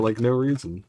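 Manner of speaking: ordinary delivery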